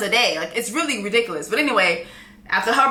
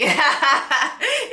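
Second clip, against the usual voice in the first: real laugh; 4 semitones higher than usual. Real, not acted. Laugh after quoted mom.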